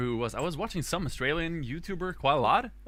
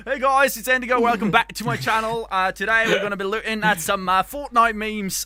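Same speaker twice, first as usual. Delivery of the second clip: loud, higher British YouTuber intro; hammed-up British channel welcome; British accent; 7 semitones higher, 5 dB louder than usual. Vlogger welcoming viewers back, showy British accent. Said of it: "I'm gonna start practicing British English".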